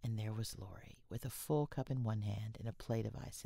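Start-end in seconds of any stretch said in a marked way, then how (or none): none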